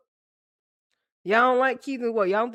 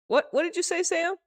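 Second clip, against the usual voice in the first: ordinary talk, nothing marked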